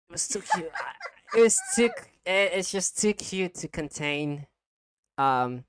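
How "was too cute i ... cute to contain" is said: giggly. Real, not acted. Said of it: "I'm so happy smiling".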